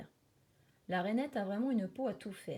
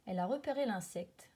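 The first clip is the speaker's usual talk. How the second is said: usual speaking voice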